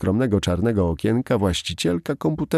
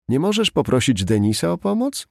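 surprised; 6 semitones higher than usual. A narrator's reading. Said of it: "zdziwiła się Dave'a".

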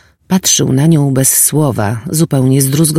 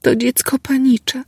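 quiet, pained voice; 13 semitones higher than usual. Acted. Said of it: "mówiła cichym, zbolałym głosem".

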